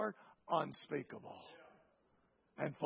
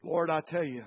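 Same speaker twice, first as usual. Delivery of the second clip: prayer. Acted. Closing prayer.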